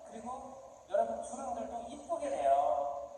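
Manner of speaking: usual speaking voice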